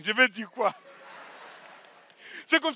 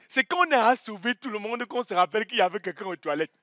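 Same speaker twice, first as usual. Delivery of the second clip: ordinary talk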